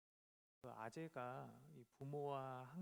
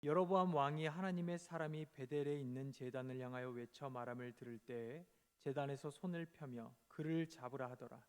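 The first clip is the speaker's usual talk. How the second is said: loud, solemn Bible reading; scripture reading; 7 dB louder than usual. Acted. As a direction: read the verses out with weight. Reads scripture aloud. (성경 봉독)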